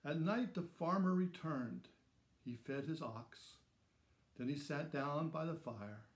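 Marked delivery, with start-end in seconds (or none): none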